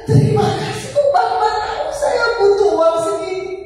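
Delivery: high, choked-up grateful thanks; tearful thanks. Retold or imitated. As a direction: thank him as if close to tears. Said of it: "Itu bapaknya yang nerima nangis".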